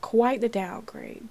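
normal talking voice

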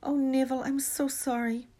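high-pitched, sorrowful apology; miserable. A narrator's reading. Hermione apologising to frozen Neville, miserable. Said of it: "said Hermione miserably".

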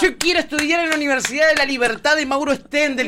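high-pitched gushing devotee; mock fervour. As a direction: gush like an adoring would-be student. Hammed-up enthusiasm for university.

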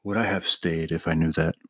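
deadpan Ron dodging a hearing question. Acted. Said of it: "you're gonna be Ron".

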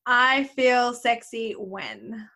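drawn out, slow, clear; slow prompt. Real, not acted. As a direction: read it out slowly, inviting. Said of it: "Finish this sentence".

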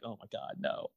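ordinary delivery

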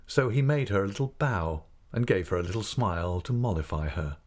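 ordinary delivery

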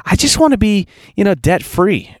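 lightly voiced hopeful woman at a gathering; mock dreamy lady. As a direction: imitate the lady's timid wish. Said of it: "She goes".

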